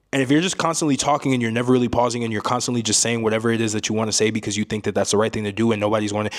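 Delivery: fast, run-on, no pauses at all; rambling with no pauses. Shown as a demonstration. Coach showing viewers what rambling sounds like, fast with no pauses. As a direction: ramble on without taking a breath. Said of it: "see, the way that I did that, I didn't pause at all".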